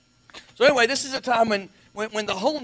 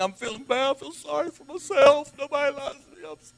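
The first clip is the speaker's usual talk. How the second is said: higher, self-pitying mock whine; mock lamenting; 5 semitones higher than usual. Preacher acting out what lament means, in mock lament. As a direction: play up feeling sorry for yourself. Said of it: "I'm lamenting".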